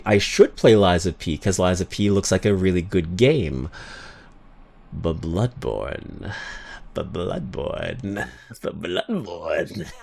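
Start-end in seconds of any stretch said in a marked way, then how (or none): 4.9-9.9 slow, hushed, much softer craving; longing whisper. Pretend. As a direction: savor the name like a treat. Longing for Bloodborne, Sonic gif.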